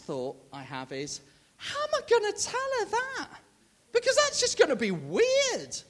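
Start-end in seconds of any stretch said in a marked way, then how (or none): 1.6-5.8 high, fretful inner voice; much higher, nervous mock whine; anxious inner voice. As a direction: voice your own panicked thought. His own panicked inner thought.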